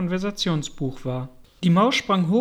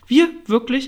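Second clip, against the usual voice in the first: higher, affronted retort; sharp questioning indignation; indignant mouse; 5 semitones higher, 7 dB louder than usual. A narrator's reading. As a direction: throw it back, offended. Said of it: "entgegnete die Maus, die bis zur Schwanzspitze zitterte".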